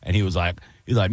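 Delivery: ordinary delivery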